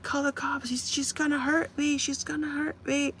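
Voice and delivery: high, panicky imitation of the frightened manager; imitating a scared plea. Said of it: "he was so scared".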